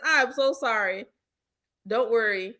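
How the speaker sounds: ordinary delivery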